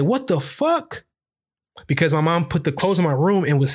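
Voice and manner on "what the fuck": high, slow startled mom voice; mom's shocked, high-pitched cuss. Retold or imitated. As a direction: squeal it out like a startled mother. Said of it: "my mom just cuss and say".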